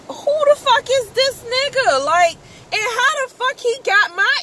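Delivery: high, indignant who the fuck; retold outrage. Hearing the hacker's name, indignant outburst. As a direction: spit it out in indignation.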